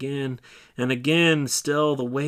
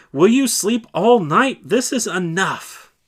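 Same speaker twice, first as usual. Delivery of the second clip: much higher and louder, exasperated; loud rebuke; 5 semitones higher, 9 dB louder than usual. Acted. Leader rousing disciples the third time, loud rebuke. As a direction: wake them for good.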